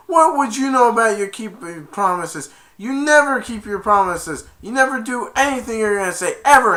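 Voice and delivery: high, loud mock outburst; hurt angry retort. Son accusing dad of broken promises, angry outburst. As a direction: hurl each accusation.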